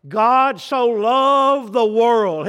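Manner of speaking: slow proclaimed scripture. Acted. Verse repeated for emphasis.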